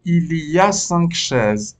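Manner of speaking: "Il y a cinq chaises" spoken very slowly and clearly; slow model reading. Shown as a demonstration. Teacher models "Il y a cinq chaises" for the pupils, slowly. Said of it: "بعدی چیه؟".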